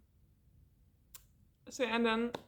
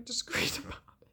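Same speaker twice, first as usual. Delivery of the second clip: whispered confession. Retold or imitated. Admitting she stalked him too, a laughing whisper. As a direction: giggly whisper.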